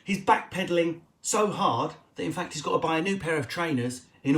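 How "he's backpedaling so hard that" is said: ordinary delivery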